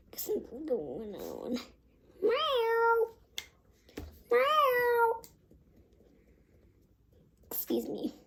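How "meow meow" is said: high, drawn-out kitty meows; cat meows. Shown as a demonstration. Playing a cat for the viewers, high meows. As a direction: meow like a little cat. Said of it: "How about you try that?".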